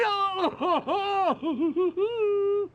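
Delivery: high, prolonged maniacal laughter; evil cackle. Acted. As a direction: cackle wildly at the top of the voice. Villain laughs at team-up.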